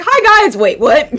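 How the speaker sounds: high, loud greeting turning baffled; Ezekiel. Acted. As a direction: greet brightly, then get confused. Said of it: "and he's just like".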